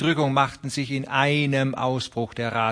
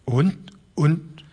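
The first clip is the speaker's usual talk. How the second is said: put-on higher, halting old man's stammer; an old farmer groping for words; the old man stammers in dismay; 6 semitones higher than usual. A narrator's reading. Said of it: "stammelte der alte Broderson".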